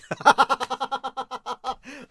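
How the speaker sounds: high loud burst of laughter; real laughing yell; laughing shout. Real, not acted. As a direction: crack up out loud. Reacting to chat joke.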